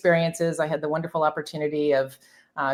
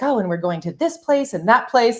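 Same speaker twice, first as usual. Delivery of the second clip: imitating excited young travelers, pitched up; mildly mock excited; 5 semitones higher, 4 dB louder than usual. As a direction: lightly gush like an eager newbie consultant. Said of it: "they were all excited".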